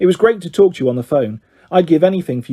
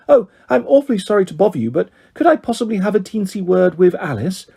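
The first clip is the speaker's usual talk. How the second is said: higher, mock-posh little miss; snooty posh girl; 4 semitones higher than usual. Girl imitating snooty classmate on the phone, mock-posh. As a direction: put on a sugary, snooty girl's request. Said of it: "this awful snooty pops girl".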